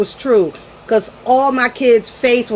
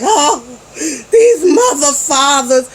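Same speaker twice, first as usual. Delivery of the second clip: slow, drawn-out half-swear under the breath; exasperated curse; 4 semitones higher, slower than usual. Retold or imitated. Mom at the wheel cursing her kids, slow and exasperated. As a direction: drag it out, fed up. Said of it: "I'm on the road like".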